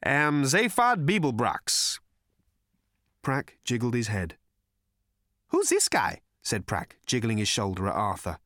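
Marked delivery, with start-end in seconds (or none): 0.0-2.0 slow, raised, self-important voice; grand announcement. A narrator's reading. Zaphod announces his name to Prack, slowly and grandly. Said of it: "an announcement of such gravity". 5.5-6.2 very high, curious character voice; manic Prack. A narrator's reading. Prack asks about silent Arthur, high and manic.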